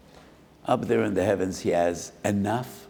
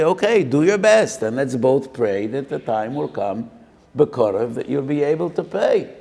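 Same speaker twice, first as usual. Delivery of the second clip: loud, high encouraging reply; lender's blessing; 5 semitones higher, 6 dB louder than usual. Acted. Lender encouraging the borrower, raised and bright. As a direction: lift him up with hope.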